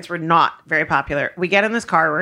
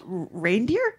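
timid answer; 2 semitones lower, 7 dB quieter, slower than usual. Retold or imitated. Frightened passenger answers the driver.

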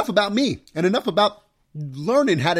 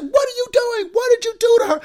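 much higher, louder alarmed wife yelling at dad; imitating wife's shriek; 4 dB louder than usual. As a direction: burst in and accuse him, shrill with alarm. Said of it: "my wife busts in and said".

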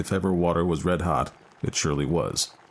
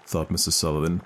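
usual speaking voice

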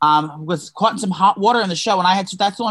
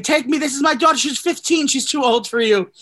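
much higher mock pleading voice; Sacha Baron Cohen begging; 8 semitones higher than usual. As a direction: squeal it out like a desperate father offering himself.

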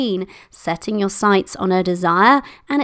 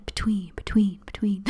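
fading whisper, each "between" softer; whispered echo; 8 dB quieter than usual. Shown as a demonstration. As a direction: mimic an echo trailing away. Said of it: "or an echo, that would be good".

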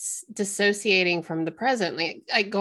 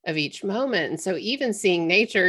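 ordinary talk, nothing marked

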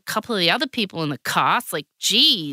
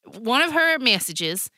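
put-on Southern US accent; 5 semitones higher than usual. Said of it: "I'll do the accent".

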